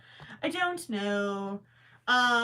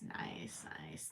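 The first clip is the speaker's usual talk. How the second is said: whispering; 15 dB quieter than usual. Real, not acted. Muttering while crocheting.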